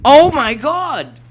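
slow, high, loud shocked cry; shocked shout. Real, not acted. Gamer shocked at an enemy in game, loud slow cry. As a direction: let the shock burst out.

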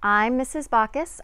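plain reading voice

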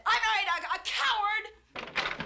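slow, drawn-out "coward"; wife insults husband, drawn-out scorn; bitter insult. Acted. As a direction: spit the word out slowly.

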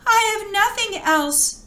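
high, helpless reply; despairing girl. A narrator's reading. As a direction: plead with nothing left to give. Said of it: "the girl replied".

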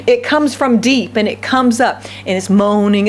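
ordinary talk, nothing marked

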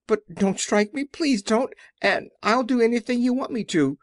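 high, pleading little man; trembling. A narrator's reading. The little man begs not to be struck, high and trembling. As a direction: beg in a high, frightened voice. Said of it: "said the little man in a trembling voice".

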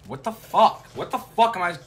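loud, high, baffled outburst; frustrated disbelief. Real, not acted. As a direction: yell it out, baffled by the game. Player baffled and frustrated mid-game.

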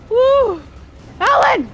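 high, loud yelling that peaks in a scream; frantic, high-pitched gamer panic; panicked shouting. Real, not acted. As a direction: shout in panic while fleeing. Fleeing the chainsaw enemy.

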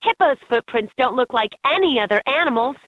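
ordinary talk

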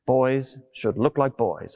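ordinary talk

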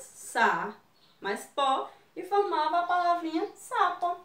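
usual speaking voice

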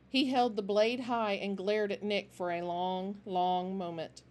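ordinary delivery, nothing marked

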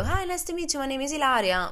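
high, sing-song polite hello; nervous girl answering the roommate in English; her own polite greeting. Retold or imitated. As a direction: put on a high, eager-to-please voice. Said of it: "E io".

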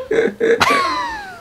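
very high cartoon giggle; high put-on cackling giggle; cartoon laugh. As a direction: squeal out a character laugh.

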